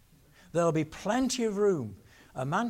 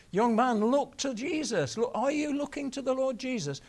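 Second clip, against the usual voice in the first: higher, louder pressing call; urgent appeal; 9 semitones higher, 3 dB louder than usual. Retold or imitated. Preacher urging the young lad in the balcony, high and loud. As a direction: press the young man hard.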